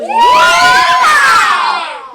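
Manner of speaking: loud whooping cowboy. Shown as a demonstration. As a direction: holler it like a cowboy. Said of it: "let's say this like a cowboy".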